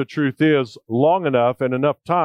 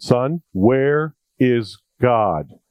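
slow stern question; much slower than usual. Retold or imitated. Preacher's third, slow asking.

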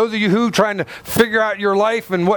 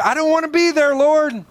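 whining Joshua; 10 semitones higher than usual. Retold or imitated. Joshua pleading with the Lord, a high mock whine. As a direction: beg the Lord to let you off. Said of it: "I can imagine Joshua's like".

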